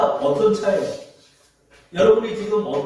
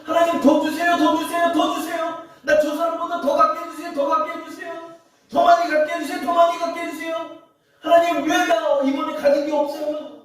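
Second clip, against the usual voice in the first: much higher, whiny begging of God; greedy prayer; 9 semitones higher than usual. Retold or imitated. Pastor mocking people who pray for more, high and whiny. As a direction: plead like a greedy child. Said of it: "막 이런 기도하는 사람 많아요".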